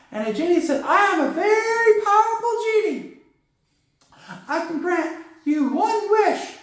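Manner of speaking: high, goofy sidekick puppet voice telling a joke; Corky's puppet voice. Acted. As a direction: keep Corky high and silly throughout. Corky tells the genie joke.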